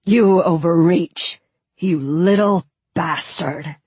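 slow, forceful insult, bitten with contempt; angry accusation. Acted. Prince rebuking his brother before the guests, slow and angry. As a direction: spit it out slowly at a rival.

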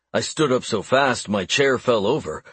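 ordinary delivery, nothing marked

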